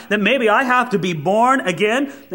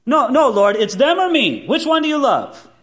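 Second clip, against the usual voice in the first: mock protest; 6 semitones higher than usual. Believer protesting to God.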